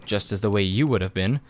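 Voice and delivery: ordinary delivery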